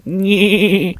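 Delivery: high bleating goat impression. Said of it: "I can do a goat impression".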